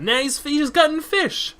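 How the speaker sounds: high, loud, slow mock shock; mock horror. Player feigns horror at the fishmonger gutting fish. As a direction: act horrified.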